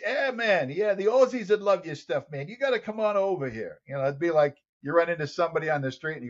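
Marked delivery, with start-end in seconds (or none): 0.0-3.8 put-on high, lively Australian accent. Inviting his new friend to Australia, Australian accent. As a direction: invite him over like an eager Aussie mate. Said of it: "here's this wild man talking to me".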